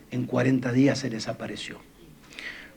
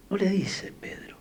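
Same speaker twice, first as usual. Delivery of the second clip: no le dice Pedro whispered, voiceless; 7 semitones higher, 4 dB quieter than usual. Shown as a demonstration. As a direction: whisper the line as an emphatic aside. (susurro enfático: no le dice Pedro)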